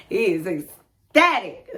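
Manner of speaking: ordinary talk, nothing marked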